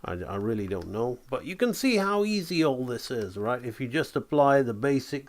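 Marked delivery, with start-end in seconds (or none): none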